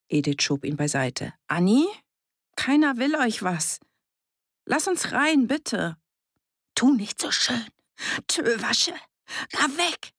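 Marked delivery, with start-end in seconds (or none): 1.5-6.0 high, coaxing appeal; raised voice, softening on the last word; pleading. A narrator's reading. Pleading at the closed door. 6.7-10.1 high, desperate hoarse cry; strained, cracking refusal. A narrator's reading. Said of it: "Wie verzweifelt sie klang".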